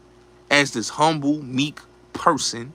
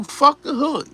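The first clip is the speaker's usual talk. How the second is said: mock tough-guy declaration, much higher; tough street talk; 10 semitones higher, 3 dB louder than usual. Imitating Hassan's boast to his audience, a higher mock tough voice. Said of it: "He'll tell you".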